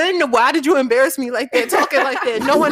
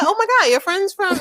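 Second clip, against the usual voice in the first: higher, louder gushing quote; retold amazement; 7 semitones higher, 3 dB louder than usual. Imitating a boy wowed by her friend, excited. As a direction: gush it like an impressed guy. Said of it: "And he was like".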